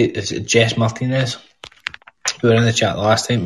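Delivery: ordinary talk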